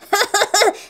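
high, put-on bully's giggle; mocking laugh. Michael laughing at Sara's shoes, mocking laugh. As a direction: laugh in mockery, high-pitched.

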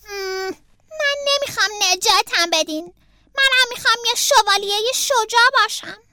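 high character voice, indignant; protesting. A narrator's reading. Beaver refuses to be rescued and wants knighthood, protesting. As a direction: start with a grumbled hum, then insist, rising.

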